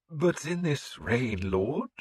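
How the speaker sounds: high, slow, wary priest. A narrator's reading. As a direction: draw it out like a doubtful cleric. Priest figure.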